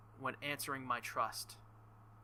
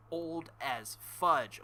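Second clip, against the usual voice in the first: drawn out; 2 semitones higher, 4 dB louder, much slower than usual. Real, not acted. Emphatic rant about character's age.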